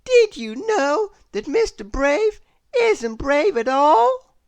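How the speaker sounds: high, loud mischievous reveal; taunting. A narrator's reading. Miss Trouble telling the crowd Mister Brave's secret, high and loud. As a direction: spill the secret gleefully.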